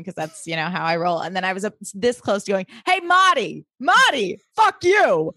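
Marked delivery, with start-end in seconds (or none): 2.9-5.3 put-on New York accent, high and brash; brash New York accent yelling at Marty. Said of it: "a New York accent".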